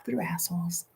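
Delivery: whispered punchline; whispered aside. Real, not acted. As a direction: slip it in as a secret joke. Laughing aside after rude quote.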